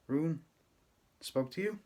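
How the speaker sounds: lightly voiced little boy, rising question; a small boy asking in wonder; child surprised. A narrator's reading. Little Alton asks Papi about Ruin, surprised rising question.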